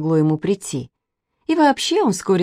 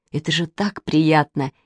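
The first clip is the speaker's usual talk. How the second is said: plain reading voice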